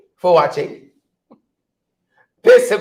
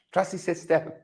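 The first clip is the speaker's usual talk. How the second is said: hushed, lower sneering slogan; mock whisper; 6 semitones lower, 13 dB quieter than usual. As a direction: breathe it out low and mocking.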